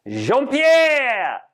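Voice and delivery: high, drawn-out show introduction; announcing call. Acted. Chef introducing his sous chef, grand shouted call. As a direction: call him on stage with a long held vowel.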